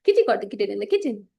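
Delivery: rattled off quickly; quick tongue twister. Shown as a demonstration. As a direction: say the twister briskly. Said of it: "I think you can all try".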